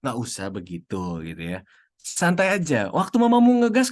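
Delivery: ordinary talk, nothing marked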